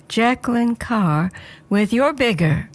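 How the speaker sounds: ordinary talk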